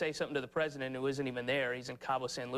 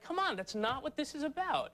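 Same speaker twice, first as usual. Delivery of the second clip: exasperated retort; 10 semitones higher than usual. Retold or imitated. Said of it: "And you're like".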